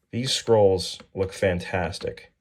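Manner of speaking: normal talking voice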